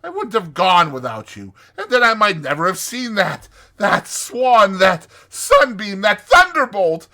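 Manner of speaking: high, loud, swooning Toad; theatrical, soaring rapture; rapturous Toad. Acted. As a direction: gush ecstatically, savoring each marvel.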